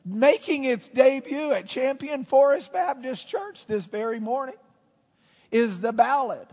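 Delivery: loud, high, showy build-up; announcer. Pretend. Preacher introducing a ballad to his church, grand announcer hype. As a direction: announce a grand debut, stretching the big words. Hypes a song debut like announcer.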